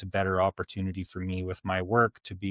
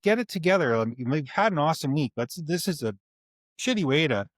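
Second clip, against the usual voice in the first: raised, pleading exasperation; retold parental scolding, higher-pitched but not furious; frustrated; 7 semitones higher than usual. Dad urging his kids to shape up, frustrated. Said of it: "not mad, frustrated".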